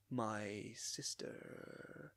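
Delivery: very slow, quiet, stretched syllables; weird drawn-out delivery. Retold or imitated. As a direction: imitate a stilted alien-like voice actor. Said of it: "he is putting on a weird accent".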